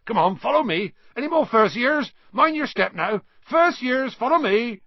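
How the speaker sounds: loud, high-pitched rounding-up calls; hearty giant gamekeeper. Acted. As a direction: shout directions to a crowd of children. Voices Hagrid rounding up students.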